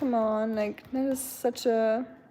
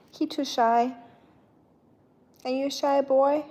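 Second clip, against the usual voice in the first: teasing pet talk; 3 semitones higher than usual. Real, not acted. Baby-talking to the shy cat.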